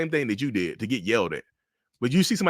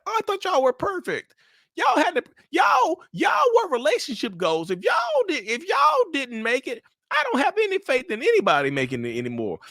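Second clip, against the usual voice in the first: gushing friend. Retold or imitated. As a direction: squeal it like a starstruck fan. Friends gushing over the breakup.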